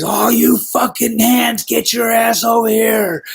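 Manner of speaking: gruff, high, slow bellow at the crew; drawn-out mock boss holler; boss yelling. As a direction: bark at the whole crew like an angry drunk boss.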